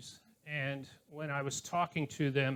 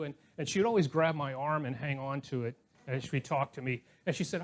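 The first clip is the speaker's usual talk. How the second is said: normal talking voice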